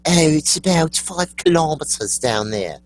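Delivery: high, exaggerated British accent; mock British accent. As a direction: put on a hammy British voice. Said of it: "You watch those Brits".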